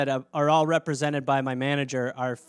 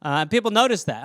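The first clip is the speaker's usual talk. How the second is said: normal talking voice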